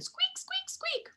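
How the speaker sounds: very high soft squeak; tiny squeaky gecko noise. Retold or imitated.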